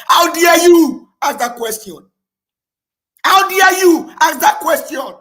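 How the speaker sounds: shouted high and loud, slowed and outraged; furious shout. Real, not acted. Speaker on a livestream, outraged at a fellow Nigerian, furious shout. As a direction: hurl the question back in fury.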